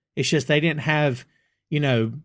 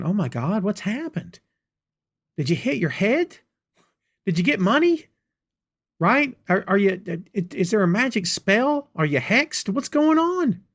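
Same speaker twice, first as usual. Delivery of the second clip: rising higher and higher in mock disbelief; incredulous disbelief; 5 semitones higher than usual. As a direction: ask it like you can't believe your ears. Thinking back on friends' claims.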